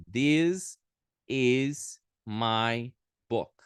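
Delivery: very slow, stretched demonstration; slow demo. Shown as a demonstration. Teacher modelling English slowly for the class. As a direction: draw out every word for learners. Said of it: "Então, ficaria".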